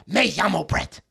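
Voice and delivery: put-on throaty schoolkid Spanish accent, voice in the back of the throat; mock Spanish-class accent. Mocking classmates who took Spanish, put-on Spanish accent. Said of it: "started getting that accent".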